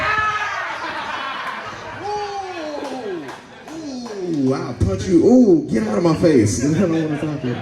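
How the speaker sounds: high-pitched comic threats to a prankster; exasperated mock fury. Acts exasperated dad after the prank.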